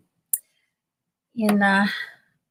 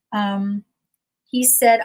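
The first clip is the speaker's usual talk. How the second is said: ordinary delivery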